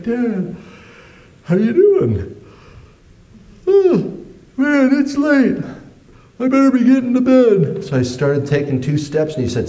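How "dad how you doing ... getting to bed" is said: high, loud forced cheerfulness; nervous fake casual. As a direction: cover the panic with cheer. Caught teen faking casual.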